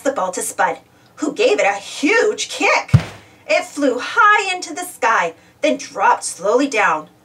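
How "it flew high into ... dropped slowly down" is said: usual speaking voice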